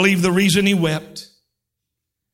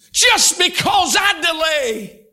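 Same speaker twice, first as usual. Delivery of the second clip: higher, slow shout of God's words; shouted line; 7 semitones higher, slower than usual. Acted. Preacher voicing God to the crowd: slow shout. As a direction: cry it out, drawn out. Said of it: "You ought to shout right there".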